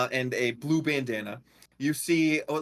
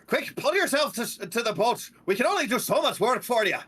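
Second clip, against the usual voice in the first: higher, brisk captain's command; lightly voiced old Leonin captain; 8 semitones higher, faster than usual. Lion captain urges swimmers aboard, brisk command. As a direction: urge them aboard like a gruff captain. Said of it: "this Leonin character goes".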